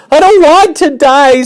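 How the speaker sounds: very high, loud scream. Acted. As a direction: shriek it flinging himself down. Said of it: "screamed Wilbur".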